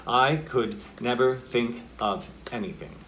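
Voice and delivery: deliberate, separate weight on each word up to "anything"; equal emphasis. Shown as a demonstration. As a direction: space out "I could never think of anything" evenly. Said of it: "if it were all in caps".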